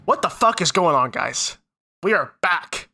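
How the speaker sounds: normal talking voice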